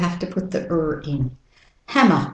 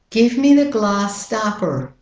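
American flat A in "glass"; American-accent model sentence; 2 semitones higher than usual. Shown as a demonstration. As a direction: sound the R in "stopper", American style. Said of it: "And with the American accent".

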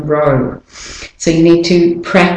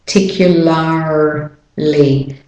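slow American "particularly", Rs fully sounded; American accent; slower than usual. Shown as a demonstration. Teacher contrasts accents for learners, American-accented "particularly". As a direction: break up "particularly" with strong American Rs. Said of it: "doing it with an American accent".

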